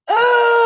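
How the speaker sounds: short high, loud scream; sudden high yell of frustration; exasperated scream. Acted. Bandmate learning the deal was never passed on, exasperated scream. Reacting to the lost record deal.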